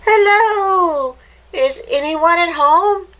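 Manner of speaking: high, loud call down a hole. A narrator's reading. Hopper calling into the hole, high and loud. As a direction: shout into the burrow to find someone.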